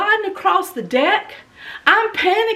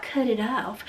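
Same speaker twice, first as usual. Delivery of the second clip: low, much softer cut it off, almost under the breath; soft murmur; 6 semitones lower, 9 dB quieter than usual. Shown as a demonstration. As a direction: toss it off softly, no urgency. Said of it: "it wasn't like".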